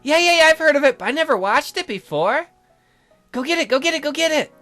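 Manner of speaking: high, eager chatter; bubbly high-pitched pleading; excited girl character. Acted. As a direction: rush it out with girlish excitement.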